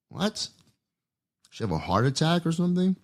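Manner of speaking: stunned, high-pitched reaction; shocked disbelief. Real, not acted. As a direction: react in real disbelief at the twist. Shocked by the story's ending.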